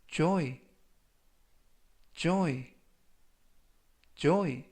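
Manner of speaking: careful vocabulary drill, one word at a time; measured teacher's demonstration with long gaps; slow model reading. Shown as a demonstration. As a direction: read it out slowly for learners to repeat. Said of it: "천천히 세 번씩 읽어드리고요".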